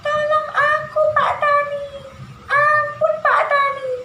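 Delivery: very high, loud pleading squeal; high-pitched, wailing mouse-deer voice; Kancil. Shown as a demonstration. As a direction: squeak out a desperate plea like a tiny trapped animal. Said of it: "Gini ya suaranya si Kancil".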